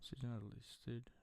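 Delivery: whispered aside; breathy soft question; whispering. Real, not acted.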